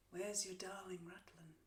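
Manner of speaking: near-whispered, cruel tease; very soft, menacing question; hushed taunt. Acted. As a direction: drop to a whisper to twist the knife. Cruel taunt about dead son.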